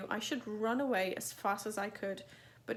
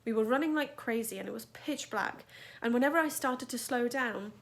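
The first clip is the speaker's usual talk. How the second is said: plain reading voice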